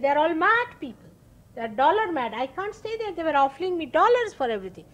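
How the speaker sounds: exasperated outburst. Retold or imitated. Guru complains about America.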